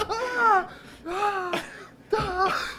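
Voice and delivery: loud imitated cries of pain, spaced apart; hammed-up high yelps from a movie scene; comic high scream. Imitating film character's repeated scream.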